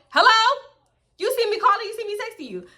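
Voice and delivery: high-pitched, exasperated demand; impatient calling out. Retold or imitated. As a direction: call out to someone ignoring you. Impatient, honking at waiting friend.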